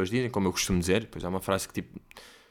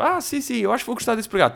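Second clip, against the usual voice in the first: high, sing-song imitation of a fawning waiter; exaggerated high-pitched polite waiter; mock waiter voice; 11 semitones higher than usual. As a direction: put on a very high, chirpy waiter voice. Mocking waiter voice to grandma.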